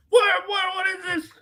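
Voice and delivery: high, far-off squeak of outrage; shocked Texan. Retold or imitated. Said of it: "Texas would be like".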